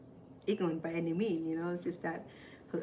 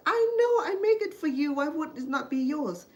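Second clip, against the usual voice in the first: much higher and louder, insisting; exasperated protest; 10 semitones higher, 7 dB louder than usual. Retold or imitated. To her sister who refuses the dessert, loud exasperated protest. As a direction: push back at her, loud and high.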